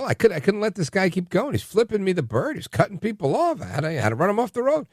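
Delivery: high, mock whiny justifying voice; mock road rager. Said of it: "and go".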